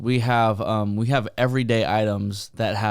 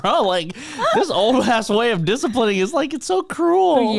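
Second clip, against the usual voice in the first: much higher, slowing at the end in disbelief; playfully appalled; mock outrage; 11 semitones higher than usual. Retelling own outrage.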